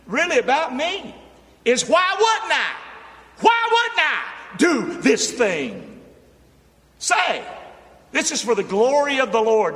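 high, loud, shouted preaching; preaching at full force. Acted. Preacher shouting the message title to the church. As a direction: drive the title home to the church.

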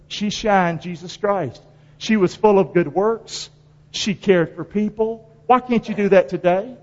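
ordinary delivery

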